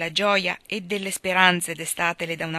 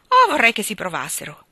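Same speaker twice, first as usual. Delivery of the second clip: louder, fierce dare; fierce exclamation; 4 semitones higher, 7 dB louder than usual. A narrator's reading. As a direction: let them just try it, defiant. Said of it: "esclamò Joe, truce".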